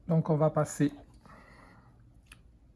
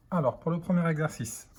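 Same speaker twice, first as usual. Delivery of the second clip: ordinary talk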